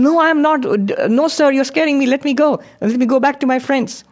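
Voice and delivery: high, scared bleating protest; bleating young lion. Acted. Young lion begs to return to his sheep friends, high bleating. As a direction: whimper it like a frightened lamb. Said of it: "And he was bleating".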